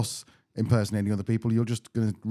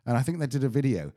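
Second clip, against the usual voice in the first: usual speaking voice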